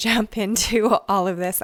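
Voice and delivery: ordinary talk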